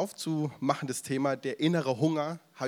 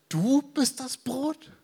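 disbelief; 10 semitones higher, much slower than usual. Retold or imitated. Crowd disbelieving Jesus.

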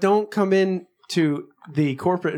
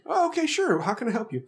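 high, sweet helpful voice; fake-nice; 8 semitones higher than usual. IT tech to coworker, fake-nice. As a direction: sound eager to please.

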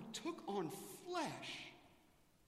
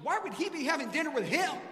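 scandalized question; 9 semitones higher, 10 dB louder than usual. Retold or imitated. Voicing the scandalized religious leaders.

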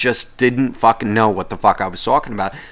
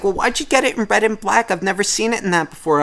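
mock high old lady; 7 semitones higher than usual. Imitating the old woman's question.

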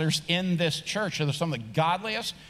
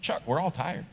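said nicely; 4 dB quieter than usual. Acted. Kirk answering his tired friend Chuck, said nicely. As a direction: let him down kindly and quietly. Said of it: "even if he says it nicely".

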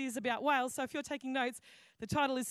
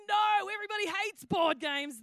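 loud, higher grumpy refusal; mock grumpy veto; 8 semitones higher, 5 dB louder than usual. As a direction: blurt it out like a grumpy husband. Husband vetoing board games.

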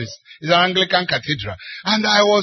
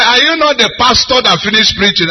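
higher, slightly louder imitated caller; insistent repeated question; 6 semitones higher, 4 dB louder than usual. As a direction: press the question on someone who balked. Caller repeats after "What did you say?.

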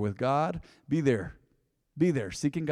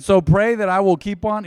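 forceful preaching; 5 semitones higher, 10 dB louder than usual. Acted. Preaching Paul's words forcefully.

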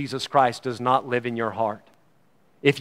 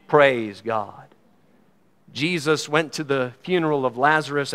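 ordinary talk, nothing marked

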